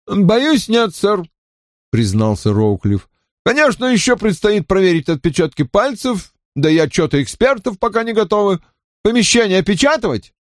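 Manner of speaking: высокий голос лейтенанта; бойкий доклад подчинённого; Роуклифф. A narrator's reading. Роуклифф отвечает Кремеру. (голос Роуклиффа)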